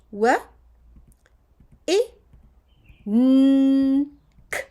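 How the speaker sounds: w, i, n, k as "wuh... ih... nnn... k"; spaced phonics sounds with a long n; sounding out. Shown as a demonstration. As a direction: say each letter sound alone with long pauses. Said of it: "So when we write it".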